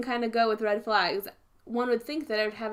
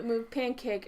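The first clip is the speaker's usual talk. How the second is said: ordinary talk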